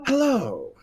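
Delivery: high, refined hello from further away. Acted. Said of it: "you hear very refined".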